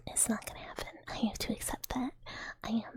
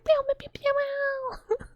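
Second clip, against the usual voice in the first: high, loud playful laser noises; mock laser gun pews; pew pew sounds; 6 dB louder, much faster than usual. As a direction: shoot like a toy blaster.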